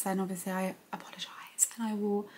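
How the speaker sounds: hushed late-night whisper. Real, not acted. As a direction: drop to a soft bedtime whisper. Child in bed at night.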